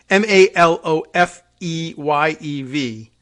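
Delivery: spelling out. Shown as a demonstration. Host contrasting two spellings, spelling slowly and loudly.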